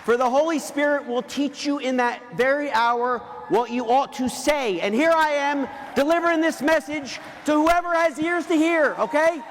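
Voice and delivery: loud, high, slowed preacher's push to the crowd; preaching at full force. Acted. Speaker rallying the event crowd before the weekend, loud preaching. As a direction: drive every word home like a revival preacher.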